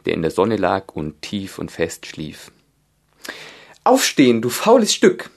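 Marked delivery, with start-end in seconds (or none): 3.8-5.3 shrill, loud, bossy queen; evil queen. A narrator's reading. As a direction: bark it at the lazy beast. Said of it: "sagte die Königin". (böse Königin, laut und herrisch)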